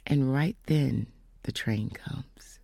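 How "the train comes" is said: slow, soft whisper; hushed reveal. Real, not acted. Telling the congregation the train arrived, whispered. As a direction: let it land in a hush. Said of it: "dramatic storytelling pause".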